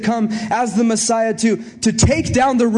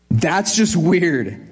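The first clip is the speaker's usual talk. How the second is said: slow emphatic; 4 semitones lower, much slower than usual. Real, not acted. Pastor remarking on odd calling.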